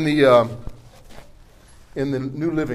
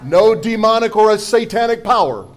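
usual speaking voice

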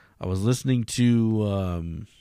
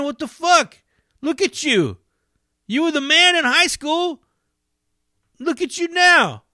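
much higher, louder jeering repeat; taunting cameraman; 3 dB louder than usual. Retold or imitated. Cameraman lighting up the homeless man, high jeering taunt. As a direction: push the insult harder. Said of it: "he starts shitting on him".

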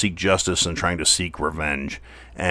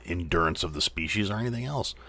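usual speaking voice

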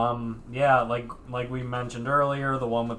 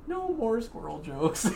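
put-on Texas accent; 11 semitones higher than usual. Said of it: "Sandy quote".

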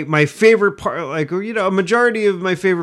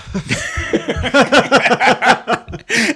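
giggling laugh; 9 semitones higher than usual. Real, not acted. Laughing at milking it.

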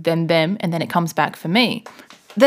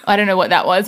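ordinary delivery

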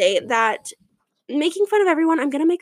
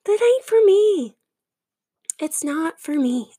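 dismissive inner voice; 6 semitones higher than usual. Retold or imitated. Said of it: "wacky things that I'm like".

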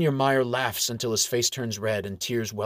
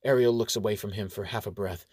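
ordinary delivery, nothing marked

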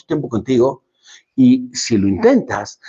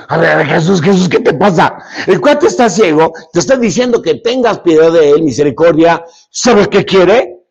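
raised, pushy voice explaining the obvious; hammed-up exasperated objection; mock impatient bystander; 2 semitones higher, 4 dB louder than usual. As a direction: butt in as if setting someone straight. Acted impatient bystander addressing Jesus.

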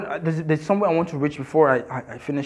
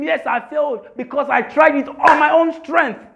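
defiant declaration; 7 semitones higher than usual. Shown as a demonstration. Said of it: "tell him".

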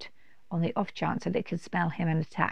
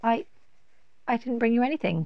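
stammering; 5 semitones higher, faster than usual. Acted. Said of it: "Kenny stammered".

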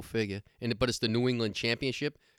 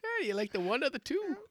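high-pitched mock Irish lilt; Irish accent; 14 semitones higher than usual. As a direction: put on a jokey, high Irish brogue. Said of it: "Can you do an Irish accent".

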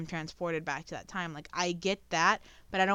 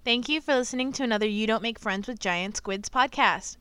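bright louder sign-off read; upbeat podcast outro; 3 semitones higher, 5 dB louder than usual. Acted. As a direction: announce it like a host.

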